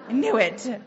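out-loud mock exasperation; punchy retold self-reproach; retold emphatic admission. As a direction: concede it firmly, acting out frustration.

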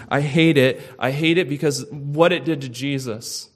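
fervent loud confession, building in force; passionate scream. Pretend. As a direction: cry it out as a repentant sinner. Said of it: "do you ever scream".

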